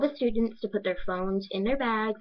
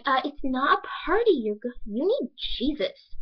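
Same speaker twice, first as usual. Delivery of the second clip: mocking imitation, pitched up and slowing; classmate's speech; 5 semitones higher than usual. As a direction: mimic her scolding with stretched words. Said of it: "this is literally how she speaks".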